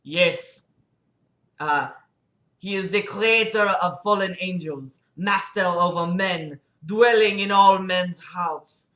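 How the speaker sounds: put-on hammy French-accented guy voice. Patient blaming God to his doctor, put-on French accent. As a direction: argue it in a thick French accent. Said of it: "I guess he's French".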